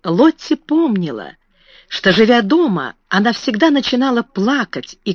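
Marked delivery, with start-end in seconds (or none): none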